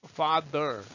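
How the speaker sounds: "father" with the tongue pulled back on the final r; r-colored ending. Shown as a demonstration. Teacher showing students the R sound, r-colored "father". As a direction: draw out "father" into a held "er". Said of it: "혀를 뒤로 당기세요".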